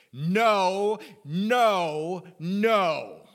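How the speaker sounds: loud, ringing no, no, no; forceful Greek double negative; emphatic triple no. Shown as a demonstration. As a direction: hammer each no home like a firm refusal. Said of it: "a double negative sounds like this".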